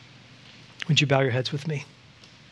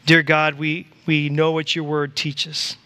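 slow, reverent prayer voice. Acted. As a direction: pray it earnestly and slowly. Leads prayer.

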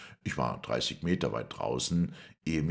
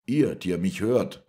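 loud, raised call to a crowd; shouting; 5 semitones higher, 8 dB louder than usual. A narrator's reading. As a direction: shout out to everyone listening. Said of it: "schrie ich". (laut schreiend)

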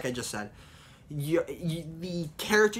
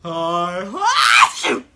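frustrated yell; 2 semitones higher, 12 dB louder than usual. Real, not acted. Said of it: "messed-up take".

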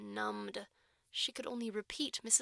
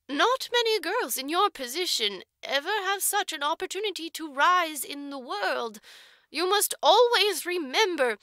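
louder, higher matron sermonizing; pompous oration; 7 semitones higher, 9 dB louder than usual. A narrator's reading. Matron orating to the orphan about gratitude, pompous and loud. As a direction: swell into a grand speech. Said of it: "It was an oratorical opportunity".

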